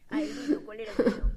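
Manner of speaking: recordando de golpe, más alto; sorpresa. Real, not acted. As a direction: se acuerda de repente. Recuerda la pregunta de golpe. (exclamación al recordar)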